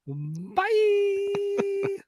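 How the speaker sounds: very high, loud, drawn-out falsetto; squealed farewell. Acted. Host signing off to listeners, squealed farewell. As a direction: stretch it into a silly high wail. Exaggerated sign-off after the show.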